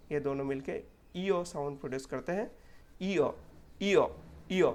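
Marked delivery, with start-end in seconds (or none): 3.0-4.7 ईओ glided clearly from ee to uh; repeated diphthong demo. Shown as a demonstration. Teacher repeating the ईओ sound for students. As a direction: sing out ईओ as one smooth glide. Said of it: "मैं तीन चार बार इसलिए बोलता हूँ".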